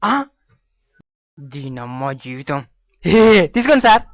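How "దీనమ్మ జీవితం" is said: slow frustrated grumble. Acted. Frustrated curse after losing money.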